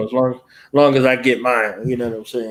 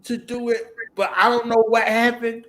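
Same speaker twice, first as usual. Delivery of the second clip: imitating a follower; 6 semitones higher, slower than usual. Mimicking someone seeking help.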